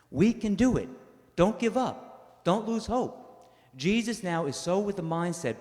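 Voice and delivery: rally the congregation; fervent preaching. Acted. Preaching at full force.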